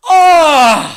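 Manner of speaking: loud burst of hype; excited shout. Real, not acted. Fan hyped for the footage, loud excited shout. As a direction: yell out in excitement.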